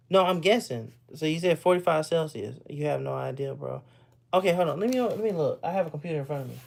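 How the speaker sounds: ordinary talk, nothing marked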